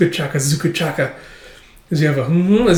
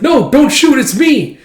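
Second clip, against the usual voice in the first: panicked plea; 12 semitones higher, 7 dB louder, slower than usual. Retold or imitated. Being shot at.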